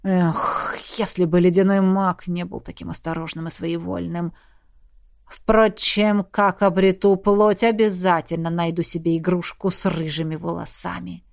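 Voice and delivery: low, soft menacing demon. A narrator's reading. Demon voiced in story.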